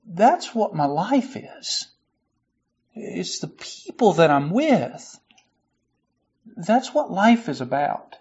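soft, slow heartfelt reflection; sincere. Real, not acted. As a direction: speak it gently, deeply moved. Moved reflection, voice dropping.

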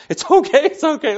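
high, loud, comically hasty comforting; frantic reassurance. Retold or imitated. Dad consoling his crying son, overdone reassurance.

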